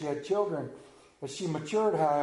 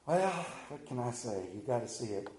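normal talking voice

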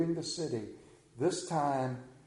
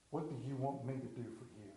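much softer, almost hushed, lower voice; hushed tender question; 5 semitones lower, 14 dB quieter than usual. Acted. Jesus gently asking the wretched beggar, softly and hushed. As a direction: ask it like an intimate offer to the lowliest beggar.

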